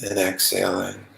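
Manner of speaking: calm, even meditation voice with long pauses; unhurried, settled meditation guiding; guided meditation. Acted. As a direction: guide them gently into relaxation, leaving long silences.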